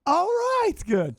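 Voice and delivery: very high, loud whoop of approval; high-pitched, over-the-top agreement; excited approval. Real, not acted. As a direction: squeal it out in delight. Exaggerated approval of film pick.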